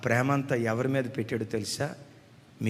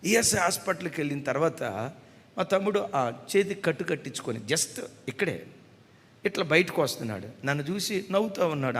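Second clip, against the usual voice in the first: ordinary delivery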